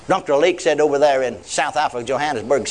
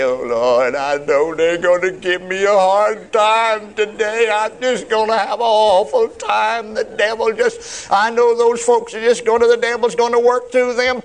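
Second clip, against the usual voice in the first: drawn-out, hammed-up whine climbing higher; mock whining prayer; 6 semitones higher, slower than usual. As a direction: imitate someone whining and crying to God. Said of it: "instead of whining and crying".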